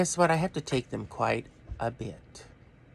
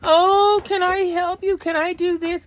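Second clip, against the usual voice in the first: very high, loud sugary mock voice; mocking sweet helper; 9 dB louder than usual. Vlogger mimicking people who fuss over someone in pain, high sugary mock voice. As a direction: parody a fussy overeager helper. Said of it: "come up to you and go".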